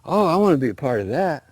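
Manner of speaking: acted keen excitement, jumping at it; all excited. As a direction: burst out eagerly. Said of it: "Possum got all excited".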